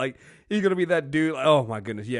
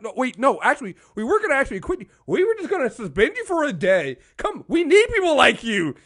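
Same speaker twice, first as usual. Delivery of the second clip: higher, rushed mock pleading; pleading bosses; 10 semitones higher, 4 dB louder, faster than usual. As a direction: beg him to stay in a hurry. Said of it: "they're like".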